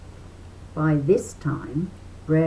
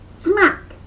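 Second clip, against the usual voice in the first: high loud cartoon smack; smack sound; 7 dB louder than usual. A narrator's reading. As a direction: punch out the hit noise. Fight sound effect.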